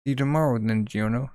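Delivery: ordinary talk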